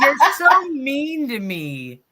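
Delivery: slow, drawn-out mock whine; hammed-up pout. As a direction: play the wounded victim. Teasing complaint at the screen tricks.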